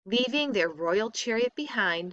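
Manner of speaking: ordinary delivery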